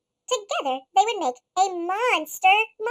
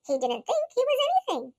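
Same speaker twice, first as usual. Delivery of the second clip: ordinary delivery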